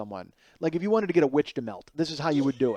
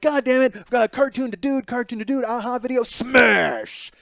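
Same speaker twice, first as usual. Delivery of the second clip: mock raging yell; 8 semitones higher, 6 dB louder than usual. Raging in shower, retelling outburst.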